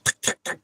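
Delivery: whispered tick-tack clicks; voiceless mimicked vibration clicks; imitated ticking noise. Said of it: "making tiki noises".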